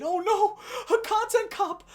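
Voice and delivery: high, loud mock panic with a fake whimper; hammed-up frightened cry, pitched high. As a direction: ham up a streamer panicking on air. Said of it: "supposed to, like, stifle him".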